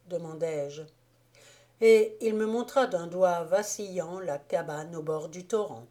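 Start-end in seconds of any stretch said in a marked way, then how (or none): none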